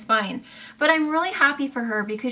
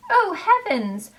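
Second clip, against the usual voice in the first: surprised exclaim; 8 semitones higher, 3 dB louder than usual. Real, not acted. Reacting to a shade.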